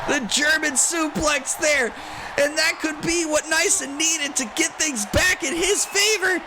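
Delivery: excited play-by-play calling; wrestling commentator. Acted. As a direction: shout the big moves live.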